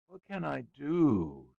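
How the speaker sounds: put-on slow, high and insistent child's demand. A narrator's reading. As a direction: drag it out like a bored boy nagging. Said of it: "he demanded of his father".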